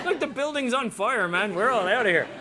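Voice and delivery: high, loud comic protest; comic outburst, rising in volume at the end; mock alarm. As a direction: exclaim in hammed-up alarm, pitched well up. Acted alarm at burning building.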